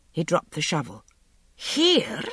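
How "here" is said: shocked croak, leaping high in pitch. A narrator's reading. Groom shocked by a young lady's proposal, croaking. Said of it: "he croaked".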